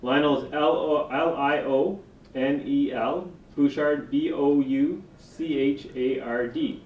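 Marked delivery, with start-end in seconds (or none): 0.5-3.3 slow letter by letter "L O, L I O N E L"; spelling it out. Real, not acted. Spelling a name for the record. 4.1-6.8 slow letter by letter "B O U C H A R D"; spelling it out. Real, not acted. Spelling a name for the record.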